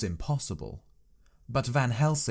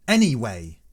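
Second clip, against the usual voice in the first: loud, sudden outburst; a sharp loud cry of protest; cried out; 8 dB louder than usual. A narrator's reading. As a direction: burst out with it. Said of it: "I cried".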